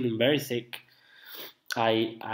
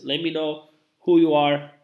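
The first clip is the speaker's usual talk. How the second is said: ordinary talk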